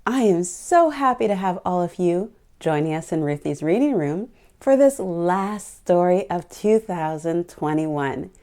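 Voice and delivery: ordinary delivery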